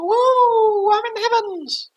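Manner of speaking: very high, loud gleeful whoop; squeaky cartoon delight; joyful spirit.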